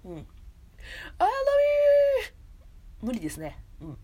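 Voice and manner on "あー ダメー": very high, drawn-out failed cry; attempted Ogata. Streamer trying an actress's voice, high mock wail. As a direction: stretch a thin high wail. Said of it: "尾形さんの声出した方がいい".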